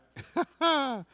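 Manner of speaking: real high-pitched chuckle; laughing. Real, not acted. Preacher amused before the next verse, laughing.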